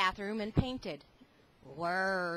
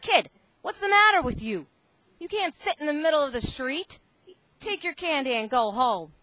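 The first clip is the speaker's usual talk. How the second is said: higher, louder stern cop; scolding policeman; 5 semitones higher, 8 dB louder than usual. Acted. Policeman finds Lance sitting in the street, scolding. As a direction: tell the kid off.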